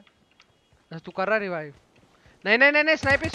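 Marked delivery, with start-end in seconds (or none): 2.4-3.3 high, loud panicked cry; panicked shout. Real, not acted. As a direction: yell in alarm for the sniper. Teammate going down. (घबराकर ऊँची चीख)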